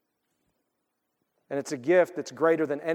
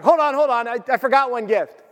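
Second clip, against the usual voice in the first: loud, high imitation of dad cutting in; dad interrupting; 8 semitones higher, 5 dB louder than usual. Dad remembering one more gift, loud interruption. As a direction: call a halt, pitched up and urgent.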